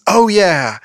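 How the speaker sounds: high, punchy cry of sudden inspiration; mock realization. Blonde in a joke suddenly gets an idea, mock excited. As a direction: blurt it high, as the idea lands.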